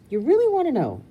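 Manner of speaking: high, loud playful challenge; teasing mom. Acted. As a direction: tease it like a mom baiting a kid. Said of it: "Mom teasing".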